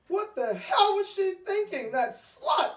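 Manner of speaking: loud, high indignant yelling; outraged shout. Acted. Wife in a coma yelling at her cheating husband, furious shout. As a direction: blow up at the cheating pair, full voice.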